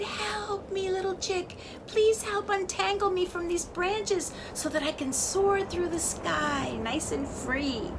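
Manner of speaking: high, pleading wind voice; a tangled wind asking for help. A narrator's reading. Wind pleading with the chick, high.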